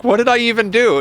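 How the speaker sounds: high, loud bewildered question; genuinely lost, pleading to know; baffled. Retold or imitated. To his brother in the dream, high and bewildered. Confused reply in retold dream.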